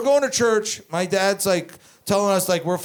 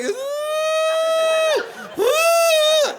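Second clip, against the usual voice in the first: put-on very high, drawn-out kid's wail; mock crying wail; 4 dB louder than usual. Imitating six-year-old brother terrified of jail, mock high wailing. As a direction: wail like a bawling child, hammed-up. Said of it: "and he's crying".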